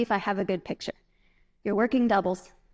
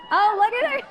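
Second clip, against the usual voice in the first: excited exclaim; 12 semitones higher, 6 dB louder than usual. Real, not acted. Audience member jumping.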